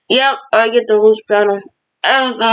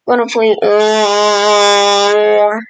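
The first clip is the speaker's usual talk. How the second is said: very slow, dragged-out words; stretched-out stall; much slower than usual. Pretend. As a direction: drag it out like a teasing build-up. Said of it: "repeats the phrase, stalling".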